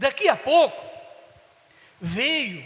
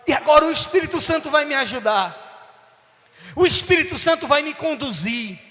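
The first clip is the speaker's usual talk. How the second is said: rising, louder sermon cry, building to a shout; fervent preaching; 4 semitones higher, 4 dB louder than usual. Acted. Preacher proclaiming the Holy Spirit to the congregation, fervent and rising. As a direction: preach it out with fire, climbing to the top.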